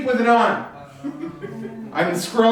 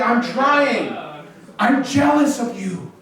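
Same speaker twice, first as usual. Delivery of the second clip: ordinary delivery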